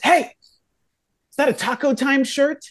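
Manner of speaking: high-pitched friendly call from a stranger; mock holler across the yard; imitated holler. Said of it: "just kinda hollers at me".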